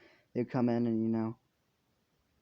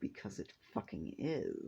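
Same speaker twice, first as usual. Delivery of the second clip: very soft, contained anger; pissed off; 3 semitones lower, 11 dB quieter than usual. Acted. Izuku turns round to answer Nezu, quietly pissed off. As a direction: drop right down, quietly seething. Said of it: "he's fucking pissed off".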